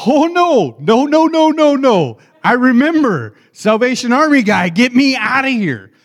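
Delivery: put-on a scared kid begging to leave, full volume; panicked cry. Young self panics seeing Bill.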